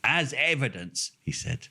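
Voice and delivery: ordinary talk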